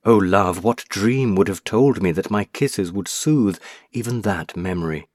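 ordinary delivery, nothing marked

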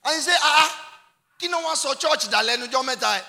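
high, loud, urgent pulpit delivery; full-force preaching. Real, not acted. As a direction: call out the congregation at full voice.

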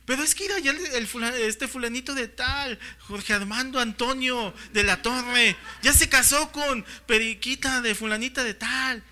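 hammed-up, savouring every name; imitated excitement. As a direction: gush like a fan recounting the plot. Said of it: "Pero emocionado, así, deleitándose".

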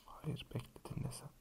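conspiratorial whisper. Real, not acted. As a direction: whisper it like a secret. Secret drink recipe, leaning in. (fısıltıyla)

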